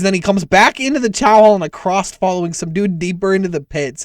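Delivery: usual speaking voice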